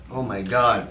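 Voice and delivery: ordinary talk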